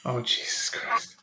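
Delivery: muttered under the breath; exasperated whisper. Real, not acted. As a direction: breathe it out like you can't believe it. Groaning at the topic.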